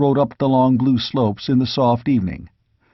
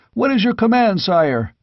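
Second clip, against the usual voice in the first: much higher, keen and ready; eager cry; 9 semitones higher than usual. A narrator's reading. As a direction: ask it burning for orders. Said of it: "they cried".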